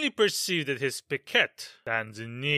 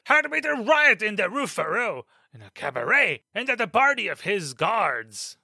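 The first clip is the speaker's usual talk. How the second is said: furious accusation. Acted. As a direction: blast them, don't let them deny it. Captain furiously accuses musketeers.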